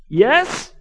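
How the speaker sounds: loud, high, exasperated "yes"; John Cleese. Retold or imitated. Vicar answering the pestering kid, exasperated Fawlty Towers manner. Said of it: "more and more like John Cleese".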